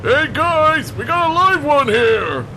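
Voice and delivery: high, loud excited shout to the guys; excited call. Acted. Onsen calls his pals about the new tenant. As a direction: yell it out to the gang.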